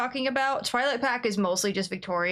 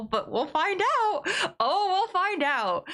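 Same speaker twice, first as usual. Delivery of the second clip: playful; 9 semitones higher than usual. Real, not acted. Teasing about outhouses.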